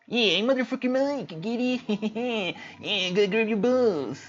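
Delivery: lightly voiced silly Mike; Mike impersonation. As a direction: play the cheeky Mike in a high voice. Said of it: "my best motherfucker Mike impersonation".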